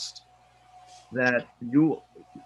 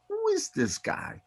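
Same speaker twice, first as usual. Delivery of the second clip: amazed; 6 dB quieter than usual. Retold or imitated. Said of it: "And I'm like".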